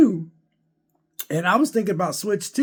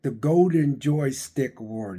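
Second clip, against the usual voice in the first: ordinary delivery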